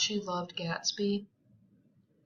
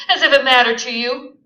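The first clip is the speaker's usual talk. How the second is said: loud, fast bitter jab; 5 semitones higher, 13 dB louder, faster than usual. Acted. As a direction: throw it at him bitterly. Daisy bitter to husband.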